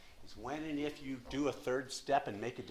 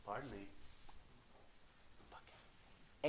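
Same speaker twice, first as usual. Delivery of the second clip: tempting inner voice; 10 dB quieter, slower than usual. Retold or imitated. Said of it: "a voice in his head will say".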